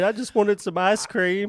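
put-on disappointed kid. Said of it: "like a kid disappointed".

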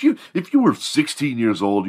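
ordinary delivery

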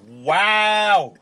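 loud, high mock outrage; retold indignant wow. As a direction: exaggerate an affronted reaction. Retold offended reaction.

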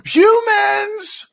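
very high, loud mock shriek; panicked alarm cry. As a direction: cry out in drawn-out, hammed-up panic. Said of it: "they're all like".

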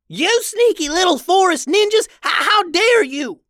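very high, loud indignant outburst; outraged villain. A narrator's reading. Ketchup-covered Hambone confronting the sisters, outraged. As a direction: yell it in a high cartoon villain voice.

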